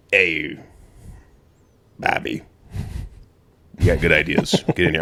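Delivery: mobster voice. Retold or imitated. Trump inviting Bobby onto his team, in a hint of a mobster voice. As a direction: play it like a small-time mob boss welcoming a new guy, lightly. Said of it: "I don't know why I went mobster on him".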